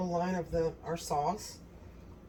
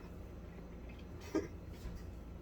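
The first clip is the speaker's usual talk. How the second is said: soft breathy laugh; 15 dB quieter than usual. Real, not acted. Laughing after tasting.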